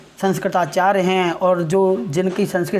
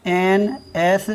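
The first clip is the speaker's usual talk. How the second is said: letters N S spelled out one by one, very slowly; slow spelling out; 3 semitones lower, much slower than usual. Shown as a demonstration. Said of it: "English में भी हम लिखते हैं क्या".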